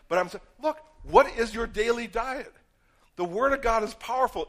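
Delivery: usual speaking voice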